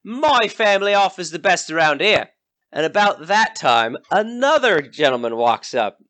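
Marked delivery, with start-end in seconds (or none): 0.0-2.3 high, pushy yokel calling out; brash rival villager. Acted. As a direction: shout down a rival host. Rival villager Jockey hails travellers.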